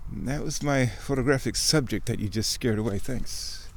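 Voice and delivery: ordinary talk, nothing marked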